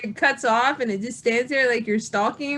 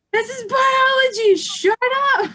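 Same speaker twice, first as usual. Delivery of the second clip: high mock shout; imitating a fed-up teen yelling; 13 semitones higher, 4 dB louder than usual. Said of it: "she's going".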